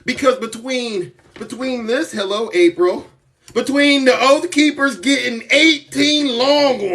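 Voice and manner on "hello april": ordinary talk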